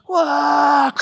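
loud, high cry of hype; excited whoop. Retold or imitated. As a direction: let out a wild cheer. Cheering dunk in warm-ups.

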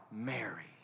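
soft low whisper; barely voiced, intimate call; hushed calling. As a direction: whisper the name tenderly. Risen Christ gently calls her name.

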